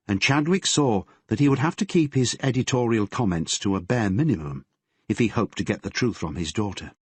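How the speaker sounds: ordinary delivery, nothing marked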